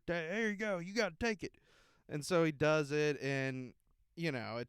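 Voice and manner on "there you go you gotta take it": mild mock yell. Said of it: "screaming at Spencer".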